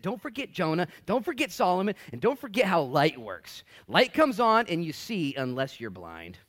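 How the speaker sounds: loud, emphatic warning; Jesus rebuking, slowing at the end; voicing Jesus. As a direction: hammer home each don't forget. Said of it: "And so he said".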